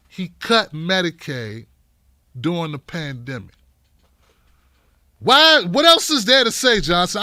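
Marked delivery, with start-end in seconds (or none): 5.2-7.2 outraged shout. Real, not acted. Outraged question.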